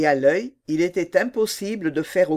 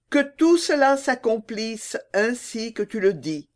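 put-on higher, firm girlish voice; firm young mermaid; 7 semitones higher than usual. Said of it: "répliqua la petite sirène avec fermeté".

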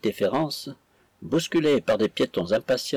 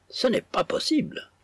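much higher, outraged disbelief; indignant; 12 semitones higher than usual. A narrator's reading. Dancer scorning her sister on the street, indignant. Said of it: "avec une vive indignation".

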